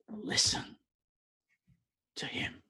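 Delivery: whispered divine command, barely voiced; hushed voice of God. Preacher whispering God's words to the congregation, hushed. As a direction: breathe it like a holy secret.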